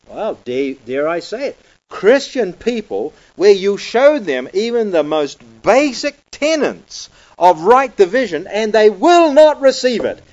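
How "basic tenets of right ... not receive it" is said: will and not receive it pushed higher and louder; stress on will. Shown as a demonstration. Preacher stresses that people will not receive it. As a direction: lean hard on will. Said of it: "I like to emphasize that word will".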